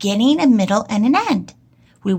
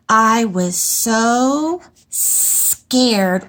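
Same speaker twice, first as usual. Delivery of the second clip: each word stretched as she writes; very slow dictation; much slower than usual. Shown as a demonstration. Teacher sounds out her sentence while writing. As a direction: draw every word out slowly. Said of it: "teacher writes sentence".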